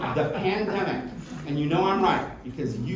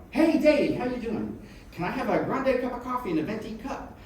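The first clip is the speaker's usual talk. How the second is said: chirpy greeting; 10 semitones higher, 4 dB louder than usual. Retold or imitated. Ordering coffee chattily.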